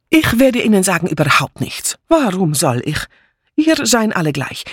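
put-on heavy foreign accent, indignant swooping pitch; defiant foreign maid. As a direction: pour out grievances like an offended refugee maid. Mitzi refuses, then accuses.

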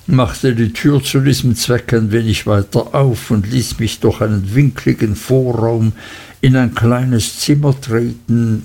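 plain reading voice

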